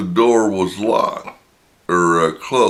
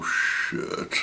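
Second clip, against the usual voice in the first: whispered, dismayed curse; hushed shocked curse; 7 dB quieter than usual. Retold or imitated. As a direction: breathe it out in dread. Retold shocked reaction.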